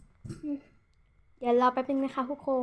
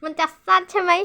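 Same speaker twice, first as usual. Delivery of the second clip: higher and louder, fed-up outburst; exasperated exclaim; 6 semitones higher, 9 dB louder than usual. Real, not acted. Complaining to viewers that dubbing takes forever, loud exasperated. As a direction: blurt it out, exasperated. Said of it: "มันต้องมาพากย์สองสามรอบเนี่ยทุกคน".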